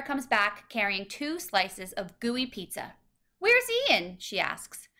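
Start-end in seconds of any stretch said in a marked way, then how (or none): 3.4-4.2 sudden high alarmed ask; alarmed question. A narrator's reading. Tara returning with pizza, alarmed. Friend finds boy missing.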